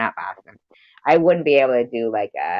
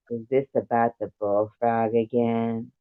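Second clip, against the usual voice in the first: understated sultry read; sexy; 3 dB quieter than usual. Acted. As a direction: play it sexy, without pushing. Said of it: "gonna do you just as sexy".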